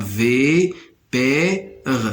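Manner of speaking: "V P R" as vi, pè, re, each apart; slow, separate syllables on "V P R"; split into syllables. Shown as a demonstration. Teacher showing three syllables, "V P R" sounded out one by one. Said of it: "se compose de trois syllabes".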